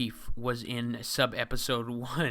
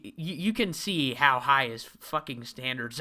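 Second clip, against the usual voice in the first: normal talking voice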